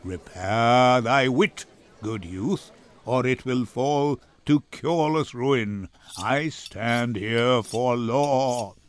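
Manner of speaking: put-on looking down on the young man, slow and cold; scornful Shylock. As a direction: Shylock answering Gratiano's insults, low and scornful. Shylock mocks Gratiano's ranting.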